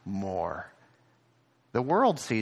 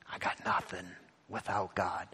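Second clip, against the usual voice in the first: whispered, softer, humble and bare; soft widow's voice; 4 semitones lower, 8 dB quieter than usual. Retold or imitated. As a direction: let the widow breathe it out in surrender. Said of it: "The widow's perspective".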